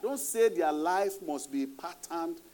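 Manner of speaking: ordinary delivery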